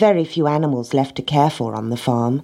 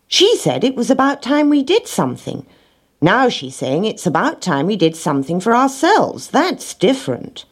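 lightly voiced higher-pitched rat; clever rat, correcting; 7 semitones higher than usual. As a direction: pick apart the wording in a higher voice.

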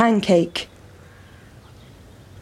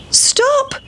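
storybook shout. A narrator's reading. Seven boys chasing the pancake, mock shout. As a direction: call out high like little boys. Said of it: "shouted the seven little boys".

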